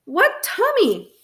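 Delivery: high, drawn-out incredulous demand; shouting. A narrator's reading. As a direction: raise the pitch sharply, stretch it out. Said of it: "I shouted at my brother".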